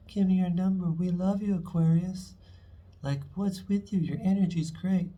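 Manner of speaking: high, loud, starstruck fan voice; gushing admirers. Pretend. Admirer begging Aquarius for their number, high and gushing. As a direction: gush like a stranger begging for a number. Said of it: "Probably having people coming up to you".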